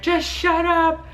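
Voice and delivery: high, loud, drawn-out mock exasperation; exasperated outburst. Labouring woman to the affirmation track, exasperated. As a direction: stretch it out, loudly fed up.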